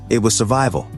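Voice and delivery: ordinary delivery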